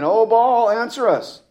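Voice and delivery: high, loud pleading call to a silent god; desperate cry. Acted. Prophets of Baal calling their god, loud and pleading.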